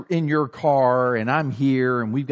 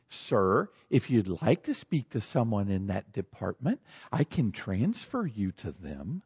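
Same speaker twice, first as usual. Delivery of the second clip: lower, measured, falsely nice phone manner; condescending; 6 semitones lower, 6 dB quieter than usual. Acted. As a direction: talk down to a caller while sounding polite. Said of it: "talking nice, but they're clearly condescending".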